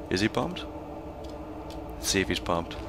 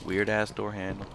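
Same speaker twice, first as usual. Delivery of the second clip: usual speaking voice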